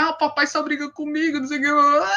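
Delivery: lightly voiced little girl complaining about daddy; a hint of a whiny child; imitating the daughter. Filha chorando reclama do pai. (voz leve de filha reclamando do pai)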